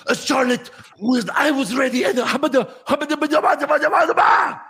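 high, loud gibberish rising to a shout; mock gibberish promo. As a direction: babble like a flustered wrestler. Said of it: "She's like".

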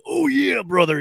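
lightly done wrestler growl; a hint of Macho Man hype. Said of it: "God, it sounds so bad".

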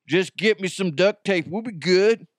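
tough guy. Acted. Gored runner asks for duct tape, tough guy. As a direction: play the unbothered wounded man.

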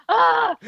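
high, loud mock ugh of frustration; exasperated groan. Reporter fed up with players teasing her, loud exasperated ugh.

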